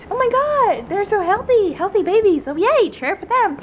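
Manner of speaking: high, swooping put-on delight; mock YouTuber. Pretend tour host praising friend's food, hammed-up YouTuber gushing. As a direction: gush over the fridge like a vlogger. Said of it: "all the YouTubers do that".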